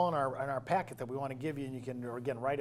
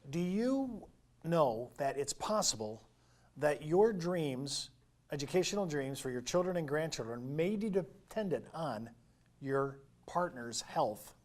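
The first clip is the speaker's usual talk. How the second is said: deliberate slow question; slower than usual. Retold or imitated. Retelling producer's weighty question.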